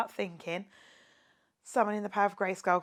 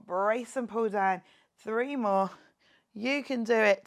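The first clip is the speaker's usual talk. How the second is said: normal talking voice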